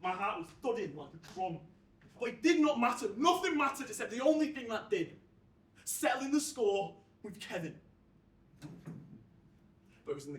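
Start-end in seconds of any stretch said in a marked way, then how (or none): 2.2-7.7 loud, emphatic declaration; fierce resolve. Acted. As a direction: drive it home with determination. Narrator resolves to settle the score.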